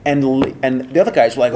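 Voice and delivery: ordinary talk, nothing marked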